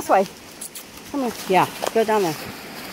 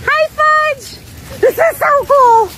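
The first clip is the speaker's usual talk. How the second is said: delighted squeal; 10 semitones higher, 4 dB louder, slower than usual. Real, not acted. Delighted by the dogs playing.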